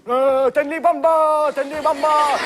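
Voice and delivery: high, drawn-out zombie begging; hungry zombie.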